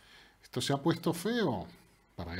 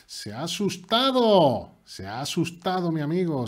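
excited shout; 3 semitones higher, 8 dB louder than usual. Real, not acted. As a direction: crow over the scared opponent. Celebra que el rival huye. (grito triunfal)

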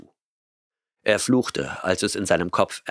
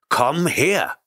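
roared command; 8 semitones higher than usual. A narrator's reading. Grant roaring at the cat to come. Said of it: "brüllte Grant".

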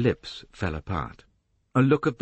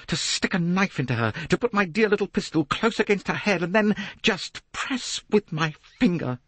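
high, excited flow of threats; feverish Jacqueline; 11 semitones higher than usual. A narrator's reading. Jacqueline confessing to Poirot she wants to hurt her, feverish. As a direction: build with relish, dipping for the fear.

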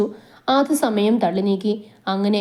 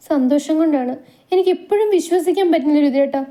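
tearful happiness; 6 semitones higher than usual. A narrator's reading. Wife overjoyed, tearful disbelief.

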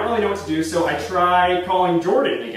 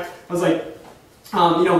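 ordinary delivery

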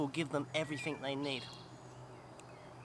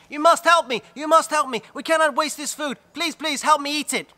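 high, loud pleading cry to the village; frantic plea shouted across the village; desperate calling out; 11 semitones higher, 12 dB louder than usual. Acted. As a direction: beg the whole village at the top of your voice. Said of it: "She was calling over the whole village".

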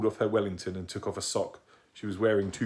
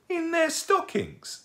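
high, squeaky, drawn-out disbelief; astonished; 4 dB louder, much slower than usual. Acted. Young owl hearing where Father Christmas puts toys, astonished. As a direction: squeak it out slowly, utterly amazed.

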